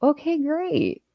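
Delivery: high, soft, slow and deflated; awkward okay. Retold or imitated. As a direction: let it fade out awkwardly. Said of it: "they were like".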